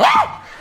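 very high, short shriek; mock terrified scream. Old lady asked for directions at night, mock terrified scream. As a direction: scream as if startled out of your wits. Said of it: "She screamed so loud, she scared me".

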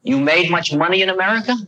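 a gentle hint of the old teacher's voice; light imitation of an old Indian holy man; old guru asking. Guru questions narrator.

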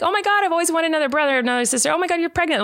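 high, gushing mock delight; excited kid. Imitating kids learning mom is pregnant, high and excited. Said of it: "crying, getting so excited".

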